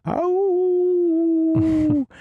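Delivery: high, loud imitated howl from the dark moor; werewolf howl. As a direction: howl out like a wolf in the distance. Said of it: "all the people in the pub hear that".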